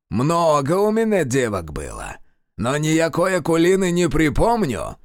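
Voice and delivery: high, mocking old man voice; sly old sorcerer. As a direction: play the cunning old warlock. Kiloviaz voice.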